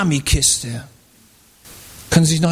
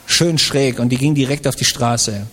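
normal talking voice

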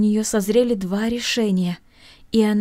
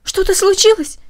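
alarmed, much higher and louder; exclaimed; 11 semitones higher, 7 dB louder than usual. A narrator's reading. Masha alarmed by the weeping aunt, high alarmed cry.

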